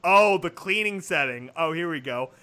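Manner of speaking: usual speaking voice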